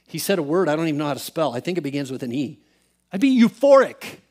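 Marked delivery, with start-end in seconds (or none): 3.1-4.2 high, loud burst of excitement; euphoric outburst. Retold or imitated. Friend says how he'd feel, euphoric outburst. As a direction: burst out as if jumping out of your skin. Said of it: "Euphoric means jumping out of your skin, excited".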